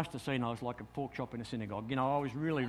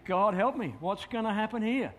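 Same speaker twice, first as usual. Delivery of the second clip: anxious thought; 6 semitones higher, 3 dB louder than usual. Retold or imitated. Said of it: "I'm thinking".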